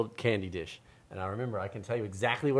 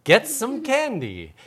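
put-on loud, slow old grandma; 11 semitones higher, 10 dB louder, much slower than usual. A narrator's reading. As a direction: offer candy like a sweet old nana. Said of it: "Nana upstairs would say".

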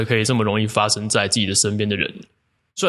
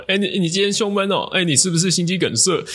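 mock teasing; 8 semitones higher than usual. 一直疯狂的消费他.

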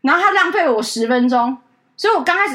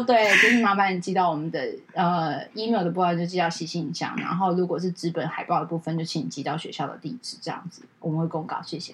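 quiet, polite retreat; sheepish softening; 9 semitones lower, 8 dB quieter than usual. Retold or imitated. Assistant, ashamed after yelling, quietly polite. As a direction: soften down apologetically. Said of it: "我自己又有那个羞耻心".

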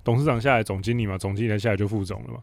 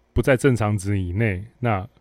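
normal talking voice

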